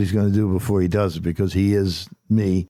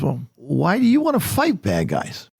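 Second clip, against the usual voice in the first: put-on high, wondering child's voice; curious little girl; 8 semitones higher than usual. Granddaughter asking Papa why he fights, high and innocent. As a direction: ask innocently like a small girl.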